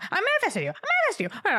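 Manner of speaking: giddy manifester squeal; manic triumph. Retold or imitated. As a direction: shriek it like a witch whose spell worked. Mock-triumphant shout of manifesting.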